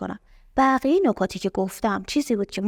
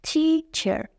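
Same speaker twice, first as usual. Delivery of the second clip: تیچر higher, in two drawn-out beats; split into syllables; 6 semitones higher, much slower than usual. Shown as a demonstration. Teacher having learners examine teacher, split into syllables. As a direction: separate tee and cher in تیچر. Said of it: "حالا نگاه کنین تیچر رو".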